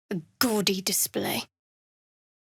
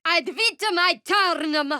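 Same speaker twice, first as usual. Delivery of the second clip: high, loud spell chant; shouted incantation; 6 semitones higher, 4 dB louder than usual. Acted. Casting a spell, shouted Latin incantation.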